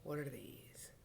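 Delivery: usual speaking voice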